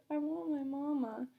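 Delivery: a hammed-up sob, voice wobbling like a child; mock sobbing. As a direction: sob it out like a toddler wanting mama. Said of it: "I sobbed".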